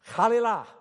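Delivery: high, loud Hebrew exclamation. Acted. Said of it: "an exclamation, and the word was this".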